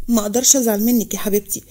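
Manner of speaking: ordinary delivery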